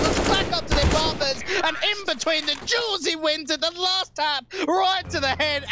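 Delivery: excited. Real, not acted. Recap after the clutch.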